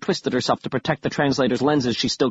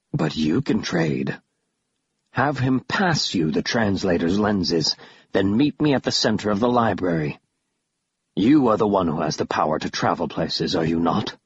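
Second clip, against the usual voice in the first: menacing creature; 5 semitones lower than usual. A narrator's reading. Killiman offers to trade her for the lenses, low menacing creature. Narrator voices Killiman, the villain.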